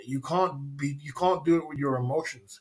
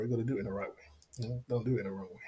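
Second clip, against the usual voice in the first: muttered; 5 semitones lower, 11 dB quieter, much faster than usual. Real, not acted. Repeating the point under his breath.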